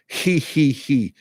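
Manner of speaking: airy forced laugh in separate puffs; simulated laughter, blowing air out. Shown as a demonstration. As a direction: push air out on each laugh syllable. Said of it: "blow that air out".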